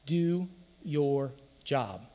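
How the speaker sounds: slow, weighty quoting of a coach's motto; preacher drawing out a coach's famous line; Belichick's motto. Retold or imitated. Slow and weighty. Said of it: "the great philosopher Bill Belichick".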